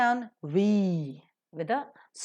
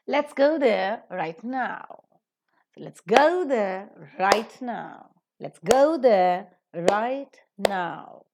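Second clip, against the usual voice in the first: slow, with "go" stressed; stress demo on go; 6 dB louder, much slower than usual. Shown as a demonstration. Teacher models the sentence for students, stressing "go". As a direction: lean on "go", weaken the rest. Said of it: "If you are emphasizing now".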